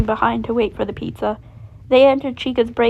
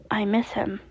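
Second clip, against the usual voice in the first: sad quiet; 7 dB quieter than usual. Acted. Sad confession.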